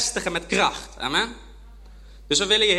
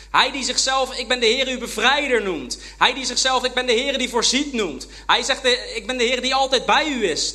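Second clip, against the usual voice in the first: higher and louder, full-force preacher; fiery preaching; 6 semitones higher, 4 dB louder than usual. Acted. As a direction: lift the congregation up with God's names. (vurig prekend, hoger en luider)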